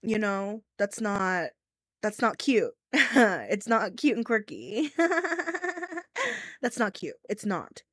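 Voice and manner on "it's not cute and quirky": laughing. Real, not acted. Laughing mid-rant.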